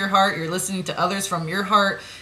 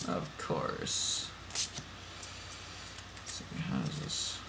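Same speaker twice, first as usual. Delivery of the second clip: whispering; 15 dB quieter, much slower than usual. Real, not acted. Whispered aside while handling cards.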